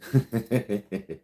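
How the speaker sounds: quick wordless laugh; chuckle. Real, not acted. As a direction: chuckle briefly to yourself. Chuckles at a viewer's comment.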